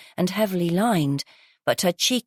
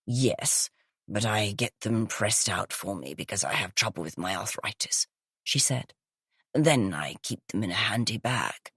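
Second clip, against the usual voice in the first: gravelly, smoky voice; 8 semitones lower, 6 dB quieter than usual. Acted. Groggy guest exclaiming to police in a low smoky rasp. Croaky woman character voice.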